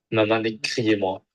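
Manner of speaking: ordinary delivery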